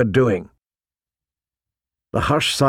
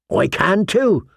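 higher-pitched, stubborn insistence; insistent; 6 semitones higher, slower than usual. A narrator's reading. Gren answering the taunt about hearing, insistent. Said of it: "Gren insisted".

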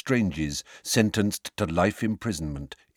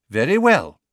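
loud, higher-pitched, commanding start; official opening a hearing; 7 semitones higher, 8 dB louder than usual. A narrator's reading. Fudge opening the hearing, official and loud. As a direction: call the room to order.

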